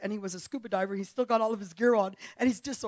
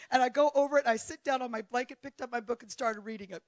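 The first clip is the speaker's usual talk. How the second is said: ordinary talk, nothing marked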